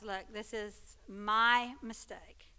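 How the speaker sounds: each word drawn out slowly; host owning his mistake, slowly; slow earnest admission. Retold or imitated. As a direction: take it slow and own it. Host admitting error on air.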